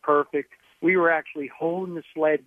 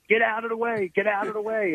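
raised-pitch retold shout; retold yelling; 7 semitones higher than usual. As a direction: re-enact calling out a warning at normal volume. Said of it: "and then I started yelling".

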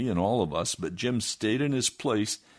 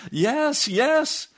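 much higher and louder, urgent; eager yes; 7 dB louder than usual. Retold or imitated. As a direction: blurt it out desperately. Eager answer to preacher.

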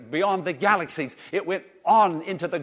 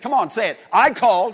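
loud, raised-pitch prompt to the crowd; call-and-response lead; 5 semitones higher, 9 dB louder, faster than usual. Shown as a demonstration. Preacher asking the congregation to repeat the verse, rousing lead. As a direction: belt out the line for them to echo.

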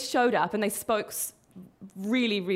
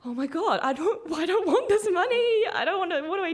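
high, laughing, flustered protest; half-laughing panic over unwanted cash; mock panic; 9 semitones higher than usual. Tourist handed scam winnings panics, high laughing fluster. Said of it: "I start to panic".

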